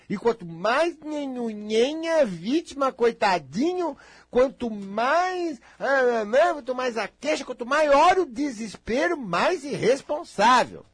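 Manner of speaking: loud, high, drawn-out mockery; mocking the victim. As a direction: stretch the key words in scornful exaggeration. Mocking those who play the victim.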